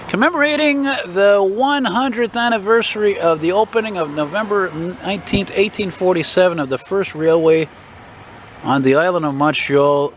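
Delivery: high, formal recitation; reading plaque aloud. Real, not acted. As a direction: read the inscription grandly.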